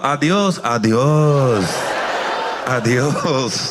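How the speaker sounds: mock Japanese accent on Spanish words. Comic to Japanese waiter, mock Japanese-accented Spanish. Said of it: "speaking Spanish in a Japanese accent".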